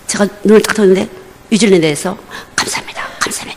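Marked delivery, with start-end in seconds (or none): 2.5-3.6 barely voiced breathy whisper of 감사합니다; whispered thanks. Pastor waking in New Zealand, whispering thanks. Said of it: "이랬어요".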